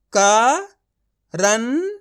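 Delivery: Current drawn out very slowly, sound by sound; slow syllable demo. Shown as a demonstration. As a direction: stretch current out slowly so each part is heard. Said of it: "तो मिलके बन जाएगा". (Current को बहुत धीरे खींचकर, अक्षर-अक्षर बोलें)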